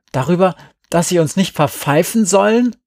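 high, loud mocking question; incredulous call. A narrator's reading. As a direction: throw it back as a challenge. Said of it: "rief Glenn".

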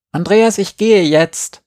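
put-on high, slow whiny little-girl call; whiny tattling little sibling. A narrator's reading. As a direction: whine it like an impatient little sister. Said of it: "quengelte meine Schwester".

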